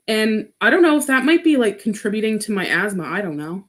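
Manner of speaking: normal talking voice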